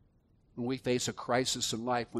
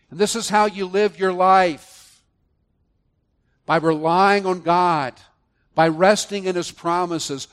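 loud, raised preaching cadence; preaching at full force; 6 semitones higher, 11 dB louder than usual. Acted. Preacher urging believers toward a living faith, loud. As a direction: lift the congregation with rising conviction.

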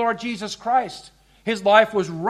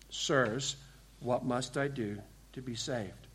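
the jailer's question much softer and lower; hushed plea; 7 semitones lower, 13 dB quieter than usual. Retold or imitated. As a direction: let the plea sink to a near-hush. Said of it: "He asks the question".

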